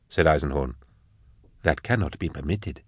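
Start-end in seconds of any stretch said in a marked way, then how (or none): 1.6-2.8 sadly. A narrator's reading. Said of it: "said Baptiste, sadly".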